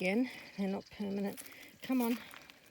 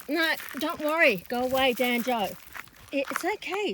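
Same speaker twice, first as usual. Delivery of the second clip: calling out; 4 semitones higher, 7 dB louder than usual. Real, not acted. Shouting horse away from frightened dog.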